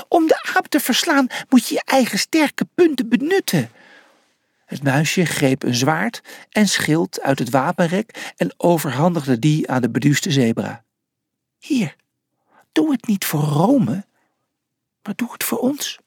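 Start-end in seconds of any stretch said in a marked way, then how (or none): 0.1-3.7 high-pitched little character voice; high, urgent pep talk; excited little coach. A narrator's reading. Small mouse shouts advice to fighter. 11.6-16.0 little helper. A narrator's reading. Mouse hands weapons to zebra.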